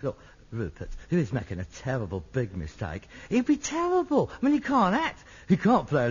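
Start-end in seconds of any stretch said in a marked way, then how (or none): 0.0-3.0 low, soft private word; confidential aside. Acted. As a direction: take him aside and lower your voice.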